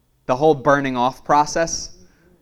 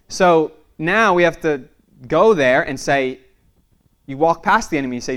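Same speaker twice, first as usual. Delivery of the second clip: ordinary delivery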